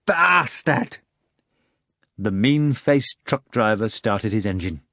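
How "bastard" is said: high, drawn-out outraged cry; screamed insult. A narrator's reading. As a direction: hurl the curse in a high yell.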